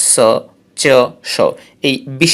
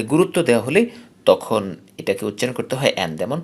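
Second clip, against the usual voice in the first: usual speaking voice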